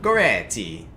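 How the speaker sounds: loud put-on Italian accent. Shown as a demonstration. Streamer guessing his wife's language, in a put-on Italian accent. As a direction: say "Goretti" loudly in an Italian accent. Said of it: "in Italian accent".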